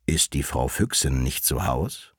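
low wolf asking politely; wolf suitor. A narrator's reading. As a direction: enquire in a deep wolf voice. Wolf asks after the vixen.

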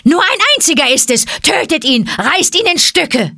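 high, fast, frantic ape screaming for blood; rally the mob to kill; monkey yelling high and loud; shrill monkey shout. A narrator's reading. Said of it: "Da ertönte plötzlich eine Stimme".

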